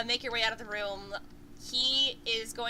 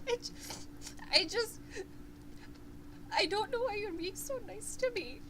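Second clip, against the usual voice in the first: higher, teary, halting voice; tearful dwarf boy; 10 semitones higher, 4 dB quieter than usual. Acted. Game master voicing the crying dwarf boy apologizing, tearful. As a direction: sniffle through the apology. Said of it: "he sniffs and he's like".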